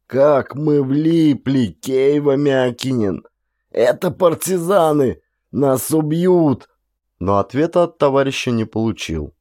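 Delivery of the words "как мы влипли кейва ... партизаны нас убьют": slow, high, drawn-out terrified moan; moaning in panic. A narrator's reading. Captured soldier moans to his comrade in terror. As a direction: wail it out, sure they are doomed. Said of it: "протяжённо застонал, приговаривая".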